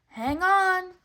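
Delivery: loud high shout. A narrator's reading. Pinocchio warning everyone on the raft, loud high shout. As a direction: shout the warning over the waves.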